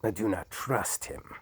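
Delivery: ordinary delivery, nothing marked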